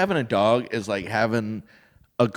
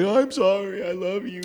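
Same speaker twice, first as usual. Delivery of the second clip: lightly high sorry coo; a hint of a guilty pet; mock apologetic pet; 7 semitones higher than usual. Dog apologizing to its owner, lightly high guilty coo.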